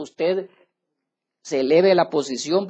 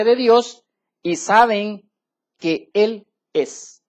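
loud, slow, emphatic sermon delivery; fiery preaching; 3 semitones higher, 6 dB louder, slower than usual. Acted. Pastor preaching to the congregation, loud and slow. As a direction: preach every word out to the congregation. Preacher reading scripture at full force.

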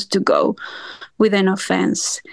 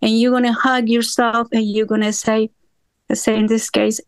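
ordinary talk

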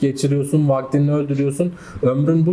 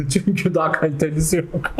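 chuckling through the words; laughing; 5 semitones higher than usual. Real, not acted. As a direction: laugh while admitting it. Joke about owning an iPhone. (gülerek)

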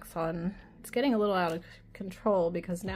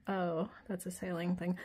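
normal talking voice

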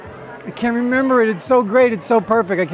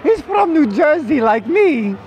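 high impersonated voice easing down; starts high, settles by the end; fading impression voice; 8 semitones higher, slower than usual. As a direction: let the high impression slide back toward normal. Impression voice easing back to own.

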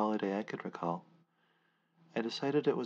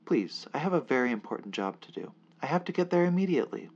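higher and louder, rushing at the end; urgent pleading; 6 semitones higher, 7 dB louder, faster than usual. Acted. Narrator begging the crowd to let him through, urgent and fast. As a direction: beg them, speeding up as panic builds. Narrator begs to get to work.

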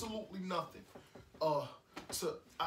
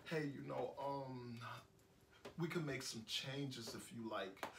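soft, low, hesitant offer to compromise; conciliatory; 5 semitones lower, 7 dB quieter than usual. Pretend. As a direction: gently float a compromise, feeling for words. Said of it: "talk to her and say".

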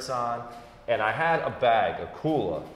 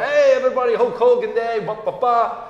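loud, high, bouncy host hype; hyped-up show intro; 10 semitones higher, 5 dB louder than usual. Retold or imitated. Host kicking off Hulk Hogan Day for viewers, loud and hyped. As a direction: pump up the crowd like a wrestling promo.

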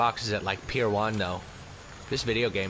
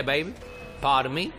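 normal talking voice

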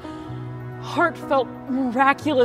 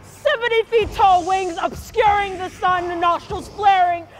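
high, loud vision cried through labor pain; frenzied loud declaiming; 5 semitones higher, 6 dB louder than usual. Acted. Woman in labor envisioning her dragon child, frenzied loud declaiming. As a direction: proclaim it wildly over the pain.

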